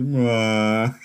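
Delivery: mock mopey Eeyore groan; teasing co-host with a glum moan; Eeyore mope. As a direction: droop it like a sad donkey. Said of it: "You're like Eeyore".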